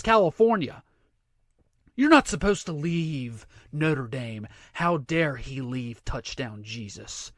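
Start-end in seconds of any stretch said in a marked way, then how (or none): 2.0-7.3 mock reproach. Mimics offended Notre Dame fans.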